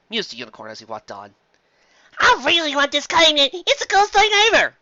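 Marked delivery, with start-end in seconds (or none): 2.2-4.7 put-on much higher, louder kid voice; excited filly. Apple Bloom gushes over the prize, high child voice. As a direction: gush like a greedy child. Foal voice.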